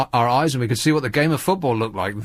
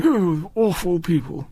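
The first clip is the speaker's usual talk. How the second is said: slow, plummy put-on upper-class sneer; posh mock voice; 3 semitones higher, slower than usual. Sneering at the fans, in a slow posh drawl. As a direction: drawl it like a snooty aristocrat. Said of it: "he's going around saying".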